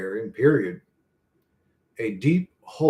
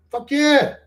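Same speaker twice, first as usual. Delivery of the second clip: excited cheer; 12 semitones higher, 5 dB louder than usual. Real, not acted. Enthusiastic agreement.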